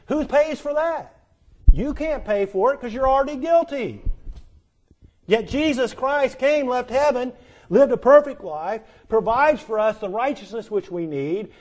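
high, loud preaching that builds and drives; full-force preaching. Acted. Preacher pressing the congregation about guilt, full-force preaching. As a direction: preach it hard, calling the sinner out.

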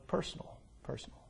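whispered, barely audible. Acted. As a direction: breathe the word out, almost silent. Preacher drops to a whisper.

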